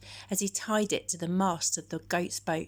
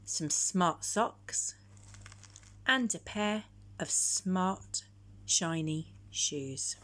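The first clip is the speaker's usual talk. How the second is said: ordinary delivery, nothing marked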